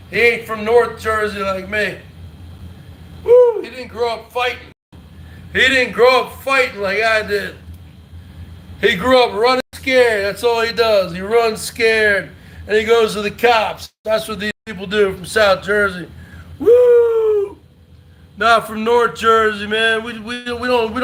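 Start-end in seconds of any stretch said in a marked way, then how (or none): 3.2-3.6 high loud celebratory whoop. Real, not acted. Streamer cheering a donor, high loud whoop. 16.6-17.6 high loud celebratory whoop. Real, not acted. Streamer cheering a donor, high loud whoop.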